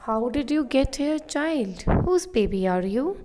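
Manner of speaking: put-on a stranger gently questioning a crying child; man asking lost child. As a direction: call out to the lost child, concerned. Man asks lost child in story.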